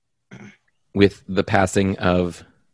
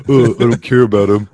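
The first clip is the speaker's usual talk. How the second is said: mocking dismissal; 5 dB louder than usual. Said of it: "You can't just dismiss it with".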